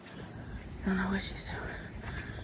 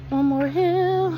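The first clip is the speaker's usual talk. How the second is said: slow, high, stretched-out One more hill; drawn-out urging; 7 semitones higher, 6 dB louder, much slower than usual. Real, not acted. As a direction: stretch every word out, higher up.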